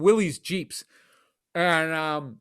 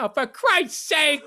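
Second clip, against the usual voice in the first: exasperated dad, slow and higher; 8 semitones higher, slower than usual. Retold or imitated. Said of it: "my dad's day off".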